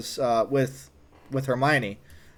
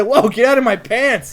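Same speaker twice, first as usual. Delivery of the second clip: higher, louder, startled yelp of protest; 8 semitones higher, 6 dB louder than usual. Retold or imitated. Teen telling Paul to get out, startled raised protest. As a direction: recoil and push him off.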